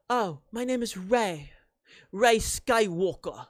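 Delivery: lightly put-on, bad British accent; Rey Skywalker. Rey tells a stranger her new name, bad British accent. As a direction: answer in a half-hearted British accent, slowing on the surname. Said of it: "That's probably a terrible accent".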